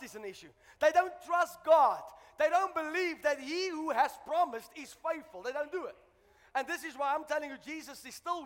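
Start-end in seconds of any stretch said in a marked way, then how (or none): 0.8-5.4 loud, slow, drawn-out declaration; forceful preaching. Real, not acted. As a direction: hammer it home to the congregation.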